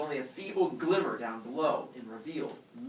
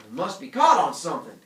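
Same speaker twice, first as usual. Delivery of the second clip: usual speaking voice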